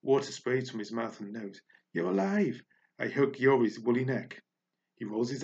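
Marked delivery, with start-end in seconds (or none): 1.9-2.6 high, bright cry of relief; joyful relief. Acted. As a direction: burst out with joy. Relief that Yuri lives.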